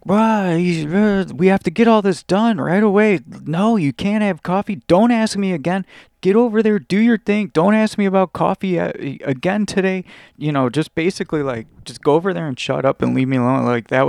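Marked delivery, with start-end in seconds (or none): 0.1-10.1 snapped. Retold or imitated. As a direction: bark it at the coworker like a furious boss. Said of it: "And she snapped".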